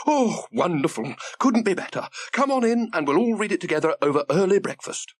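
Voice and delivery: high, lively granny voice; an eager grandmother, delighted by the news. Acted.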